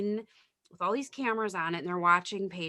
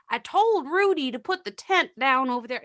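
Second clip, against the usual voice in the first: louder, higher mock old lady; insistent patient impression, pitched up; confused patient; 6 semitones higher, 8 dB louder than usual. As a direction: imitate a confused patient insisting, raised and loud.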